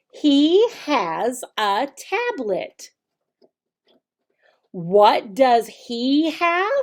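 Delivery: slow, exaggerated teacher voice, brighter on questions; slow teacher modeling. Shown as a demonstration. Teacher modeling the lesson for children, slow and clear. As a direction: model each sentence slowly and clearly for little kids. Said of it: "this is how you would start teaching".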